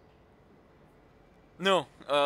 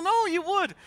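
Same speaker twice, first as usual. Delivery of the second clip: high daughter voice; 14 semitones higher, 5 dB louder than usual. Retold or imitated.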